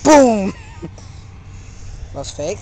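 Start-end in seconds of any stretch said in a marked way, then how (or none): 0.0-0.5 punchy mouth-made gun sounds; gunshot noises. Acted. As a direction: blast out booms like a kid playing shooter. Imitating a single shot.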